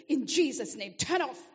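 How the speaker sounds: loud, mock-forceful command; shouted prayer command. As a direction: cast it out of the tap at full voice. Acted command to dripping tap.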